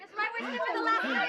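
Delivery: loud, fast indignant retort. Acted. Wife scolds her husband for being gone, loud and fast. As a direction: fire it back at him, loud and quick.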